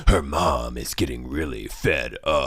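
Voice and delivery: slow, low trailer narrator; booming movie trailer voice; movie announcer voice. Acted. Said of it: "movie announcer voice".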